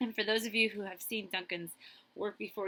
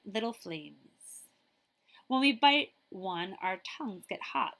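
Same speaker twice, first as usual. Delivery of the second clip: lively storybook reading; reading to children; 2 semitones higher, 6 dB louder than usual. Acted. Librarian reading a picture book to children, lively. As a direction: read it like story time for kids.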